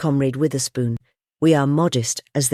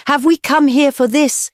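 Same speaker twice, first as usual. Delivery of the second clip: high, loud rousing speech; scornful higher louder harangue; fiery oration; 10 semitones higher, 3 dB louder than usual. A narrator's reading. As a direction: stir the crowd to anger. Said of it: "voice that made every man jump".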